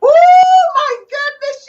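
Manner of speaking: high, slow, stretched-out swoon; excited swoon. Real, not acted. Host swooning at the guest's love song, drawn-out squeal. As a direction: melt over the song choice. Host reacts to [name] pick.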